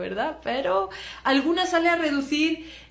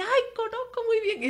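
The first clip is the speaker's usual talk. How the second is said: much higher, bright surprised "ay"; mock delighted recognition; 12 semitones higher than usual. Butcher telling a Spaniard he knows Badajoz, higher mock delight. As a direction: play the butcher pleased to know the place.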